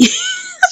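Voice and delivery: very high, brief giggle; short laugh. Real, not acted. Recapper amused by Flora's smiling exit, short high giggle. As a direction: let out a quick high giggle.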